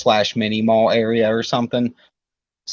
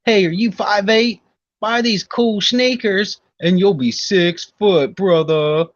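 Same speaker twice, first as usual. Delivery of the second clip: high, slow hawker's call; put-on huckster voice, stretched out; mock sales pitch; 10 semitones higher, 4 dB louder, slower than usual. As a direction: sell it like a hammed-up pitchman.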